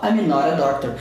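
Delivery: "not a doctor" said as "nora doctor"; linked flap T. Shown as a demonstration. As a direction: tap the T between "not" and "a". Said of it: "falar isso aqui rápido".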